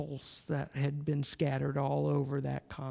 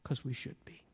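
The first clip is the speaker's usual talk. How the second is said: soft, hushed conviction; whispered; 3 semitones lower, 9 dB quieter than usual. Acted. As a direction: breathe it out quietly. Sermon whispered ending.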